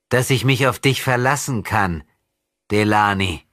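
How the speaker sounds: put-on a demon in a child's body toying with his enemy; possessed boy taunting. Possessed boy mocking André's rescue, sinister.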